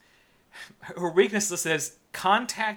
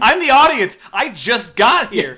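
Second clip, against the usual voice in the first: mock confused audience; 6 semitones higher, 9 dB louder than usual.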